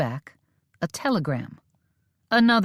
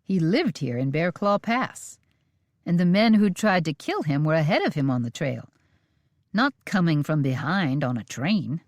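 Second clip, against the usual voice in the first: usual speaking voice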